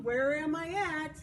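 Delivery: usual speaking voice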